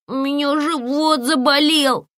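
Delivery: put-on high child's voice, suddenly unwell; a little boy with a tummy ache; sick child. A narrator's reading. (детский голос, жалобно)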